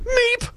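sudden very high mouse-like squeak; high startled meep; tiny squeak. Retold or imitated. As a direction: squeak like a startled deer in headlights. Dumbfounded kid's tiny squeak.

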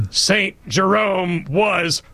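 very slow, drawn-out stern verdict; stern teacher impression. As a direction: channel the strict teacher and condemn him. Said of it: "invoke the spirit of Missus Champion".

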